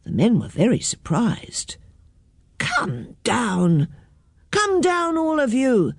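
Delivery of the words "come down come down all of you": drawn-out order rising higher; mild storybook shout; called order. A narrator's reading. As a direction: call them down, without real volume. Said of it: "they called".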